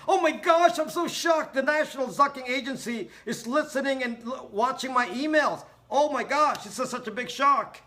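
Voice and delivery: high, loud, hammed-up shocked voice; mock panic. Mocking a viewer shocked about the NSA, high hammed-up panic. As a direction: shriek it like a hysterical fan. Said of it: "you tell me".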